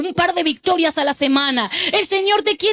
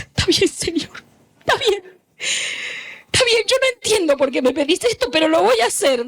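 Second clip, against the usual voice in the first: imitating tearful giving in; 5 semitones higher than usual. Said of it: "ella lloraba y decía".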